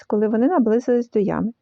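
usual speaking voice

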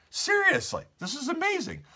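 high, amazed burst; excited. Real, not acted. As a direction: sound genuinely amazed. Marvelling at the news story.

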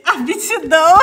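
loud laugh over the word; laughing loudly. Real, not acted. Laughing at herself to her viewers. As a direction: burst out laughing.

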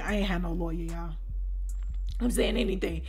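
low, soft admission; confiding aside. Real, not acted. Storyteller admitting her bluff to listeners, hushed aside. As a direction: drop down low to let listeners in.

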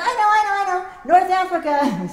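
high, loud, bursting "I know, I know"; the kid straining to be picked first; eager kid. Retold or imitated. Eager kid answering the question.

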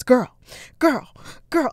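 sharp, high, very loud repeated call; imitating loud phone voice. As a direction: punch each word out like a blaring phone. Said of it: "expecting it to be so loud".

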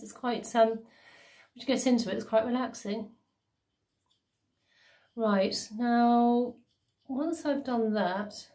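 wet mask on lips; slightly stiff lip movement; through a sheet face mask. Real, not acted.

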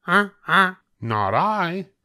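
a goose flatly refusing. A narrator's reading. Goose refusing to help the hen in a high voice. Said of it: "said the goose".

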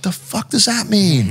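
high, slow bewildered mutter; confused under the breath; baffled. Retold or imitated. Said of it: "I'm like".

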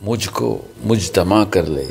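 slow, measured Urdu verse reading; ghazal recitation. Real, not acted. Poet's son reciting his father's ghazal to friends, measured recitation. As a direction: recite poetry with weighted pauses.

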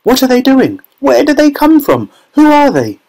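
very high, overlapping-crowd exclamations; excited shouting. A narrator's reading. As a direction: fire off high, astonished cries. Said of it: "everybody started shouting at once".

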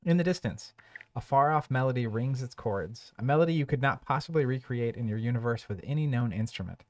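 measured storybook narration; narrator voice. Acted. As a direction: read it like a dark tale. Reads game scenario text.

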